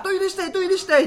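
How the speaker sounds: 高い子供っぽい声で; toddler daughter. Retold or imitated. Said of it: "二歳半の娘が". (二歳半の娘の真似)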